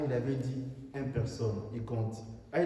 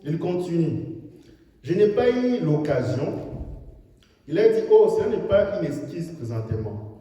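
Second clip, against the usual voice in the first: loud, high fervent sermon delivery; full-force preaching; 5 semitones higher, 8 dB louder than usual. Acted. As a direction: preach it out to the hall. Preacher retells critic scene.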